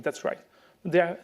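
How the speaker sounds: ordinary talk, nothing marked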